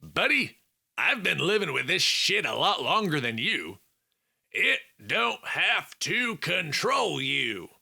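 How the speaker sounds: put-on Southern-accented buddy reassuring a struggling friend; southern twang. A narrator's reading. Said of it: "Felix said with a southern twang".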